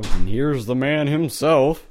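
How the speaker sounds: announcer voice. Acted. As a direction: present him like a show host. Announcing brother's arrival.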